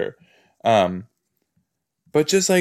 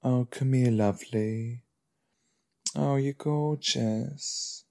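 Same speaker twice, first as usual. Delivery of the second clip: cooing; 9 dB quieter, slower than usual. Real, not acted. Cooing at a pet.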